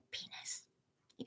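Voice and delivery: whispered naughty word. Real, not acted. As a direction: whisper it so the stream won't hear. Saying rude word on live stream.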